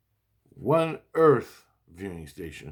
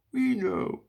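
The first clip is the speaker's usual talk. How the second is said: very high mock child voice; imitating kids; 13 semitones higher than usual. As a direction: pipe up like a little kid. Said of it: "my little, my kids".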